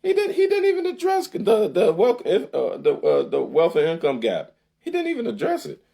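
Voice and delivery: nearly shrieking in outrage; exasperated disbelief. Real, not acted. Host venting at Biden's omission, high and loud.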